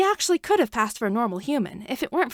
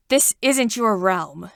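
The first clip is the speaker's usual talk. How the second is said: louder, slow, hard command; shouted; 6 dB louder, slower than usual. A narrator's reading. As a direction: shout him back to his realm. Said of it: "he shouted at her".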